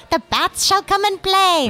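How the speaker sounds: high theatrical villain voice; arch villain voice. Shown as a demonstration. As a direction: croon it like a gleeful villain. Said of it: "arch villain voice".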